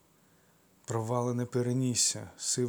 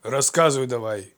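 barked order; 8 dB louder than usual. Acted. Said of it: "гаркнув Амфітріон".

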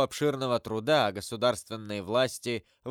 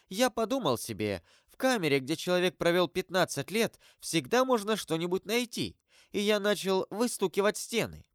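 gatekeeper storyteller; 7 semitones higher than usual. Acted. Gatekeeper tells his discovery.

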